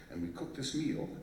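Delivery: normal talking voice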